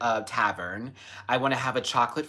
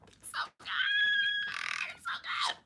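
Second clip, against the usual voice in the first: whisper; 6 dB quieter than usual. Real, not acted. Whispered cake rapture.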